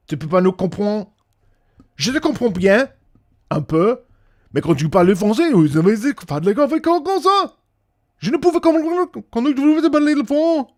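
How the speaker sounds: high, loud, excitable mock French; imitated French chatter. Streamer imitating French people talking, high excitable babble. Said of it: "That's how the French".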